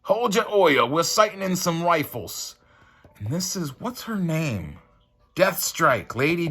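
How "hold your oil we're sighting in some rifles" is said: high, loud hammed-up Australian; mock Australian accent. Mocking Australian villains, hammed-up Australian accent. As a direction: play a rowdy Aussie bloke. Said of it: "love Australian accents".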